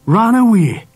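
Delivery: high, disgusted disbelief. A narrator's reading. Dad hears his son ran away, disgusted. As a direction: throw it back at him in disgust. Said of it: "his voice full of disgust".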